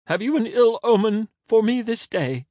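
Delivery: high, loud, urgent voice; Caesar's anxious plea. A narrator's reading. Caesar asking the blind beggar for an omen, high plea. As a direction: plead in a very high voice.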